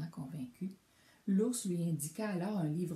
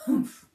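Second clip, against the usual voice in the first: loud, higher scornful humph; grumpy humph; 12 dB louder than usual. A narrator's reading. Bear scoffing at the mountain of books, loud humph. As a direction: snort out your disdain. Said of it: "lança-t-il de sa grosse voix".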